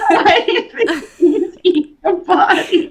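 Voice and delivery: high-pitched, incredulous laughing question; incredulous, cracking up; laughing disbelief. Real, not acted. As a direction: ask it through real laughter.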